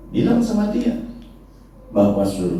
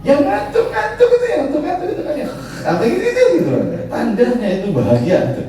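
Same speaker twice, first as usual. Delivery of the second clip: much higher, laughing; laughing tease. Real, not acted. Preacher joking about drowsy congregants, high and laughing. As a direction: chuckle at the drowsy ones.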